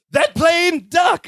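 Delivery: high, sudden warning cry; mock warning shout. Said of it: "Then he goes".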